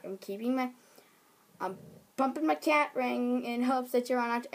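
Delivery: ordinary delivery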